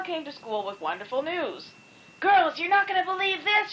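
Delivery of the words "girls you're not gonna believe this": high, thrilled announcement; excited. A narrator's reading. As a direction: burst out with the news. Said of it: "she exclaimed".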